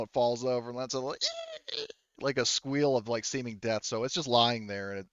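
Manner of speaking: normal talking voice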